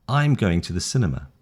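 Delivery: plain statement with the voice falling on "cinema"; falling statement tune. Shown as a demonstration. Teacher repeats the statement for learners to copy, falling statement tune. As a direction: end "cinema" going down. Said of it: "Down. You see the ending?".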